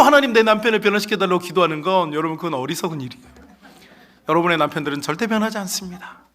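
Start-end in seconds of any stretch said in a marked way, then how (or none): 0.0-1.4 higher, louder, laughing plea; mock pleading prayer. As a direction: beg God to change the husband, half laughing. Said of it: "그래서 항상 기도드려요". (웃으며 높고 크게 간청하는 기도)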